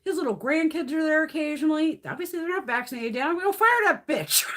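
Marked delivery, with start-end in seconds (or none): 3.3-4.4 mock fury. Loudly threatens the secretary.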